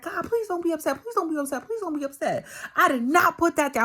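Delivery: high, loud desperate begging; pleading. Retold or imitated. Dreaming she begs God to not be upset, high pleading. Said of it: "I was pleading".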